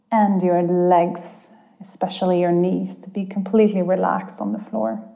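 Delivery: calm guide easing listeners inward. Acted. Leads a guided meditation.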